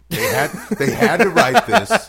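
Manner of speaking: loud, high, through laughter; laughing. Real, not acted. Comic laughing at his own story of open-mic poets, laughing. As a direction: laugh hard while getting the words out.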